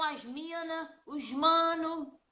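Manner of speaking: a hint of São Paulo slang, os mano drawn out slowly; lightly mimicking São Paulo speech. Teacher mimics São Paulo slang, lightly drawled. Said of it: "Em São Paulo, eles falam".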